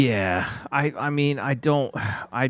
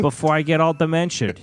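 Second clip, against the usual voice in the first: higher-pitched mock Boston Marine; Boston accent; 5 semitones higher, 3 dB louder than usual. Imitating a Marine fretting about age, Boston accent. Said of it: "from Boston".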